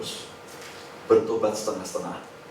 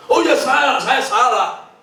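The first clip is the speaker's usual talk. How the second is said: higher, louder, hammed-up confession; loud mock apology; 11 semitones higher, 8 dB louder, slower than usual. Preacher mocks a fake penitent, loud hammed-up apology. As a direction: overact the sorry, loudly.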